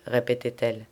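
plain reading voice

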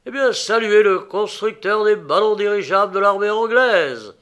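loud, high, triumphant proclamation; joyful cry. A narrator's reading. Inventor proclaims his appointment to the count, joyful cry. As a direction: cry it out with proud joy. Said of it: "s'écria Philippe joyeusement".

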